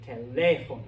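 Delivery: teléfono, loud LÉ then falling away; stress on second syllable. Shown as a demonstration. Teacher drills the accented word for students, teléfono stressed on LÉ. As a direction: hit the LÉ in teléfono. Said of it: "we put extra stress".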